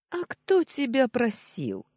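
high, loud, slow retort; scornful remark. A narrator's reading. Nephew scorning his uncle's complaint, scornful remark. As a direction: throw the question back with disdain. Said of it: "скривившись, заметил я".

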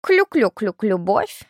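slow, repeated clucks turning into a word; clucking hen. A narrator's reading. Second hen confirming the gossip, clucking. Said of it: "подтвердила вторая".

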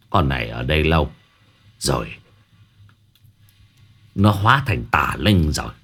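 low, grave sorcerer's voice, pronouncing doom; a shaman warning darkly; stern shaman. Acted. Shaman to the homeowner about the evil spirit, low and grave.

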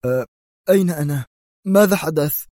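waking confused and scared; bewildered panic; alarmed mumble. Acted. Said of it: "يغمغم في ارتياع".

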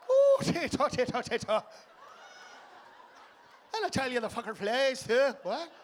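loud, hammed-up Irish accent. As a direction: burlesque an excitable Irishman. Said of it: "if the fucker was Irish".

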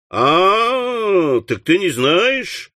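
drawn-out, rising 'oh, so'; knowing, stretched-out reply; mock understanding. Master mocks the boy's answer.

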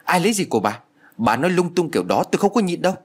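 high, fast indignant retort. A narrator's reading. Maid Sáu Chi fed up, retorting to the mistress, high indignant. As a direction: snap back, fed up. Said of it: "cô phản ứng liền".

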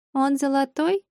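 put-on high-pitched little kid asking; high childlike question; small child's voice. A narrator's reading. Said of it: "тихо спросил детский голосок".

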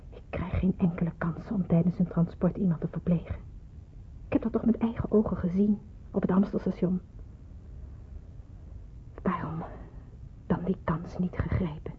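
hushed, unvoiced whisper, low; whispering to herself. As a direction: brood over it in a whisper, half to yourself. Alone, brooding over the nurse's words.

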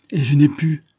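high, loud burst of rage; furious outcry. A narrator's reading. Gaston cries out in rage at the slanderers. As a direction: explode with helpless fury. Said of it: "s'écriait-il".

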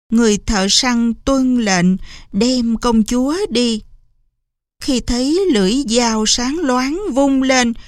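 plain reading voice

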